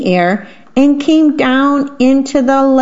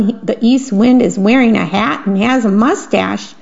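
ordinary delivery, nothing marked

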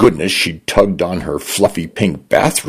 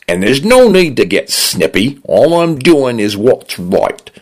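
cooler Cockney voice, pitched up; stern Cockney; 8 semitones higher than usual. A narrator's reading. The condo scolds Dinah in a cooler Cockney tone. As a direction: drop the cheer, scold in Cockney. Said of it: "Brightness fell from the voice".

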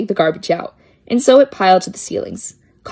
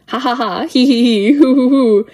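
spooky laugh; 4 semitones higher, 3 dB louder than usual. Acted. Ghostly laughter in haunted house.

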